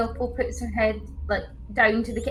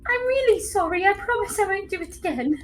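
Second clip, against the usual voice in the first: a hint of a sheepish kid; 6 semitones higher than usual. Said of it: "Takes the bat and goes".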